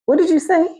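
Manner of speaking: can't believe what was just offered; stunned disbelief. Retold or imitated. Caller offers a job and she asks again, stunned. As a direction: pitch jumps high in stunned disbelief.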